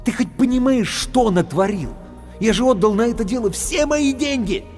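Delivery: angry rant. A narrator's reading. Hero scolding the sword about lost money. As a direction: lay into the sword.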